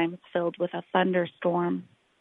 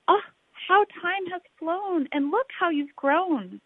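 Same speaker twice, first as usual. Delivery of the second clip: put-on fond old birds; 10 semitones higher than usual. The flock on the rock greets the grown snail, high fond cooing. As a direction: coo it up high like doting aunties.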